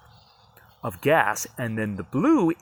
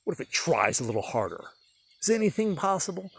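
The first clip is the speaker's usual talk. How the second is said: imagined skeptic voice; 6 semitones higher than usual. Retold or imitated. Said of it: "you might be like".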